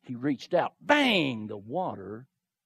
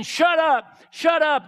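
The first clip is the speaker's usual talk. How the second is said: higher, sharp hushing shout; hushing rebuke; 8 semitones higher, 5 dB louder, slower than usual. Acted. Friends hushing blind Bartimaeus on the road, sharp higher rebuke. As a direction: shut him up fast and loud.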